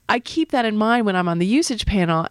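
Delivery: usual speaking voice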